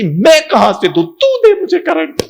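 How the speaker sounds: high, loud mock retort; wife as a fan. Wife fan hitting back at her husband, high and loud. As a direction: throw it back at him shrilly. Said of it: "पत्नी ... कहती".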